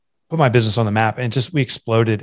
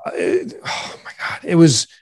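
real choked-up emotion; 5 semitones higher than usual. Real, not acted. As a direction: struggle to get words out through emotion. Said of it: "I'm getting emotional right now".

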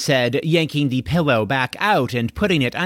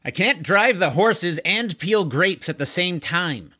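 mild yell; 6 semitones higher than usual. Acted. Driver answering the grape complaint, mildly yelled. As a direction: call back in exasperation, restrained. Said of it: "yelled the square-jawed horseman".

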